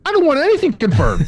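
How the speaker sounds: high, strained exasperation; high-pitched exasperated refusal; exasperated retort. Retold or imitated. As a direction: snap it back in disbelief, voice way up. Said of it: "I was like".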